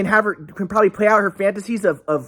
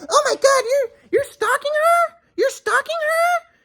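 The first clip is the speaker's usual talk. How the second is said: much higher, slow, whiny shriek; mock shocked friend; slower than usual. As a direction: parody her horrified gasp. Friend accusing him of stalking.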